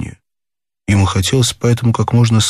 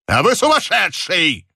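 exasperated, raised pitch; higher-pitched angry detective; angry outcry; 9 semitones higher than usual. A narrator's reading. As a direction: burst out in irritation. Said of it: "гневно закричал сыщик".

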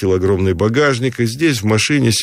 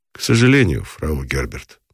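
slowed-down answer; 8 semitones lower than usual. A narrator's reading. Guest regretfully answering the Frau, slowed down. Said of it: "Он отвечал ей так же замедленно".

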